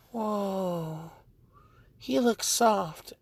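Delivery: put-on high, soft little girl in awe; Eri. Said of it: "then she's just like".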